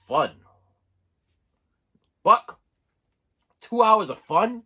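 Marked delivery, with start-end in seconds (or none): 2.2-4.6 high, loud delighted double take; can't believe the bargain, two hours of fun for a buck; gleeful mock outrage at the price; excited disbelief. Real, not acted. Amazed at a dollar bargain.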